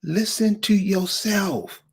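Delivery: ordinary talk, nothing marked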